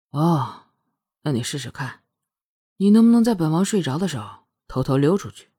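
offhand, low, sure of himself; casual confidence. A narrator's reading. Prince dares her to slip out, nonchalant yet confident. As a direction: challenge lightly in a low, unworried voice. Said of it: "听着漫不经心， 却是透着不容怀疑的自信".

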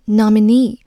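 teacher modelling nominee, stress on the final "nee". Shown as a demonstration. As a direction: hit the last syllable of nominee, "nom-i-NEE". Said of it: "它的重音在最后一个knee的前面".

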